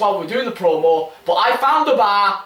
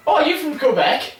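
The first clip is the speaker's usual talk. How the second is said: higher, put-on curious query; teasing, pitched-up test question; mock-innocent question; 8 semitones higher than usual. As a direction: bait a Canadian with feigned innocence. Acted question baiting a Canadian.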